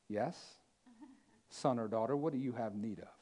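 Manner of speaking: very soft, low, gentle reply; tender divine answer. Acted. Jesus answering one who cried out, hushed tenderness. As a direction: answer a child in a hush.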